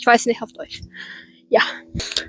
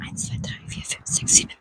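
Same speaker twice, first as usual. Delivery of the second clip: whispered counting; 12 dB quieter than usual. Real, not acted. Counting under her breath.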